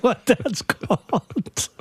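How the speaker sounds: breaking up with laughter, slowing down; laughing through words. Real, not acted. Comedian tickled by a silly word, helplessly laughing. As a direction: crack up halfway through the sentence.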